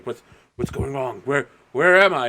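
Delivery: lost souls calling out in confusion; confused men's voices. Acted. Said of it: "like, a dozen men going".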